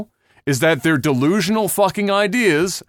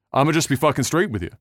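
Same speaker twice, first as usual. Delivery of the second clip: ordinary talk, nothing marked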